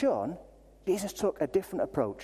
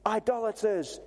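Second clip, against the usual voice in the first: put-on fire-and-brimstone denunciation, higher and drawn out; mock condemning preacher; 4 semitones higher, slower than usual. Paul as he didn't speak to idol worshippers, mock condemnation. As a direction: imitate a haranguing preacher, stretching the words. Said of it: "wade in there telling people".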